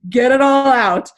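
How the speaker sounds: rousing herself to cry it out; pep talk to self. Retold or imitated. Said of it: "I was like".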